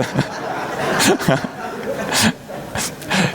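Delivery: a long, airy laugh under the breath; breathy laugh. Real, not acted. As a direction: let out a soft, breathless laugh. Laughs at own remark.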